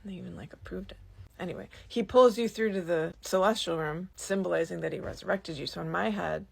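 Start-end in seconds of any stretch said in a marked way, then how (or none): 0.0-1.0 breathy secret whisper; whispered aside. Real, not acted. Whispered aside about approval.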